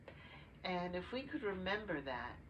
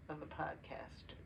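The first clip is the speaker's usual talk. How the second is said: whispered aside; 2 semitones lower, 7 dB quieter than usual. Real, not acted. Whispered aside after episode mention.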